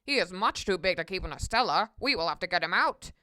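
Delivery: deep-voiced cop taking charge; stern policeman. A narrator's reading. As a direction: answer the caller with gruff authority.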